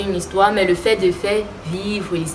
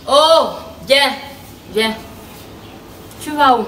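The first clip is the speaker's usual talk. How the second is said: higher, sharper call to halt her; calling to stop someone; 5 semitones higher than usual. Acted. Mother stops her daughter leaving, calling out in a higher voice. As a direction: call her back, pitched up.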